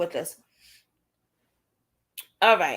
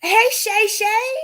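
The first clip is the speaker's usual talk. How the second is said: sing-song greeting; 9 semitones higher, 5 dB louder, much slower than usual. Real, not acted. Greeting viewer in chat.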